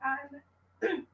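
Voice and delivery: usual speaking voice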